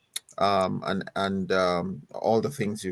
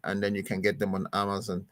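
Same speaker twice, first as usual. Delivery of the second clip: ordinary talk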